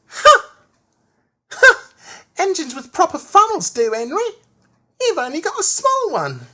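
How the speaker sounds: high, loud, squeaky little engine; scornful ha, then taunt in a high voice; Percy's cheeky retort. A narrator's reading. Percy answering Henry back, cheeky high-pitched jeer. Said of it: "But Percy answered".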